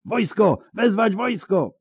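very high-pitched acted shout; high cry of alarm. A narrator's reading. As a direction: call urgently for help. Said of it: "krzyknął drugi górnik".

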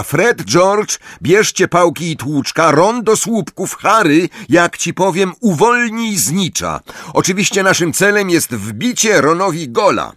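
high, energetic captain's instructions; called-out orders. A narrator's reading. Angelina sending players to positions, calling out high. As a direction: call each player to their post. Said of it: "zawołała Angelina".

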